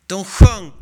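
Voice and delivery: sudden loud cry of sjöng; emphatic preaching. Real, not acted. Preacher proclaiming to the congregation, sudden loud emphasis. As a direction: hit it hard from the pulpit. Preacher driving home his point.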